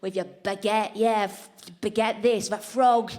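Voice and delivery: dull, laddish English heckler; mock English lout getting really into it; jeering English people. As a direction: taunt clumsily, punching out each insult. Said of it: "they get really into it".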